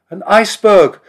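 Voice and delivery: high, loud cry of alarm; shouting. A narrator's reading. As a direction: shout it out in shock. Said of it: "Simon heard the sailors shouting".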